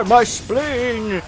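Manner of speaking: parodying a dying game enemy, high loud wail; mock death cry. Sick of hearing enemies' catchphrase, mimics their shouted cry. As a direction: cry out like a hammy dying henchman. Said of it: "shout out".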